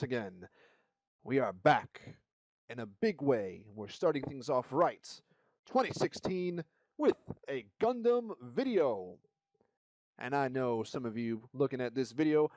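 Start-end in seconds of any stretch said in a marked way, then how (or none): none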